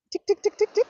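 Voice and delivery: quick high-pitched ticks; imitated keypad ticking. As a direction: tick like keys being tapped. Imitates dialling a phone code.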